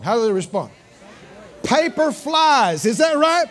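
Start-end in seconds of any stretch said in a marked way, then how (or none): 1.6-2.8 excited. Acted. Excited kids tearing gifts.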